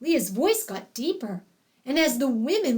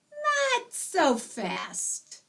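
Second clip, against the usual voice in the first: slow cautious; 8 semitones higher, much slower than usual. Acted. Father cautions slowly.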